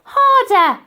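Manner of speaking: high, loud urging shout. Acted. Old lady urging more force.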